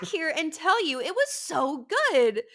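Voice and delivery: high, slow gushing praise; a customer gushing her thanks, high and slow. Retold or imitated. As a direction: coo it like a delighted customer.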